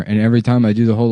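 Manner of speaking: ordinary talk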